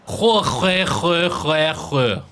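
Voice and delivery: "sjö" with a harsh back-of-throat fricative; rasped Swedish sj. Shown as a demonstration. Streamer drilling Swedish, "sjö" with a throaty rasped sj. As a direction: scrape the start of every "sjö" in the throat, repeated quickly.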